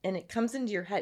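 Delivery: ordinary delivery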